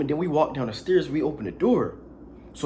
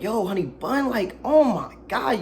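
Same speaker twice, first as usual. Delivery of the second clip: higher, drawn-out oh my God in mock horror; mock disgust; 7 semitones higher, slower than usual. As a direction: act grossed out, stretching it slowly. Reacting to the smell, retold.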